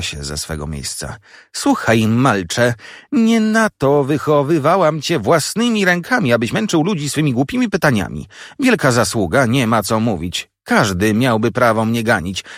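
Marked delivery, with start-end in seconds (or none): 1.5-12.4 high-pitched, exasperated telling-off; angry tirade. A narrator's reading. Sister jumps up and sends the boy off, angry tirade. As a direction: berate the child, pitch leaping up to the final order.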